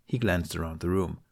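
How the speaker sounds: usual speaking voice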